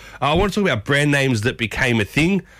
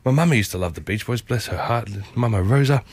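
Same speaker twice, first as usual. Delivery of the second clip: ordinary delivery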